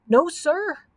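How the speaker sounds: high-pitched, eager submission; meek answer. A narrator's reading. Giant obeying the stern knight, answering meekly. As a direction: answer up like a scolded child. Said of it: "answered the giant meekly".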